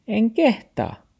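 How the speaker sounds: high-pitched excuse; taxi character. A narrator's reading. Taxi makes excuses to grandfather, high-pitched. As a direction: wriggle out in a high little voice.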